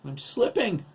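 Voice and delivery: high, loud cry of panic; alarmed cry. A narrator's reading. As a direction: cry out as you lose your grip. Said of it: "he cried".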